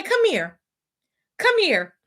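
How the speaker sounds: high called-out come here; calling out. Retold or imitated. Hunter calling his man down from the audience, loud call. Hunter calls Kip down from stands.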